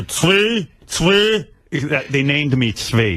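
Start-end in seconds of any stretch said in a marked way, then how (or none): 0.0-1.5 high-pitched mock calling of a pupil's name; imitating Hebrew teacher. Hebrew school teacher comes over to pupil, imitated high-pitched calling. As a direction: call the name twice in a raised teacher voice. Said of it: "He'd come over".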